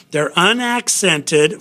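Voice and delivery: ordinary talk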